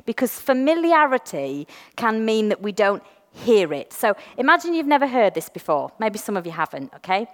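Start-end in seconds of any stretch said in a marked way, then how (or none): none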